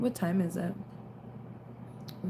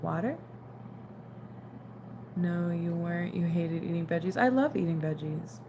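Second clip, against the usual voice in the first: usual speaking voice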